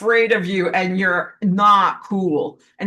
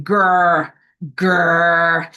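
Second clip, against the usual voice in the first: dog growling; 5 dB louder than usual. Pretend.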